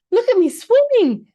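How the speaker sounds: high squeaky joey voice; squeaky little Roo. A narrator's reading. Roo calling to everyone from the pool, squeaky and proud. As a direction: squeak it like a proud tiny kangaroo.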